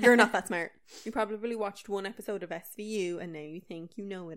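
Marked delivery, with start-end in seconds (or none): 0.0-0.7 louder scornful jab; loud scolding. Real, not acted. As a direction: bark it at him. Scolding Lewis.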